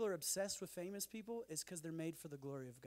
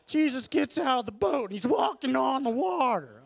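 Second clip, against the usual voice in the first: much higher, very loud, frantic shouting; manic comedy yell; 8 semitones higher, 18 dB louder than usual. Retold or imitated. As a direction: bellow it like a wild comedian. Comic impression keeps going.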